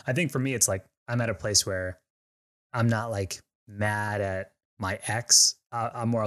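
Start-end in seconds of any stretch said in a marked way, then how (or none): none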